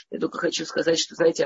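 ordinary talk, nothing marked

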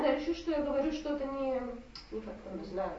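ordinary talk, nothing marked

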